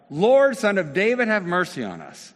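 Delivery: high, loud desperate cry. Retold or imitated. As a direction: cry out with everything you have. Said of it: "they only shouted louder".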